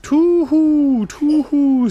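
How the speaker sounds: high, loud owl hoots; hooting owl. A narrator's reading. Owl reacting to the name of Aslan, hooting. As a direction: hoot it out like an owl. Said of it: "said the owl".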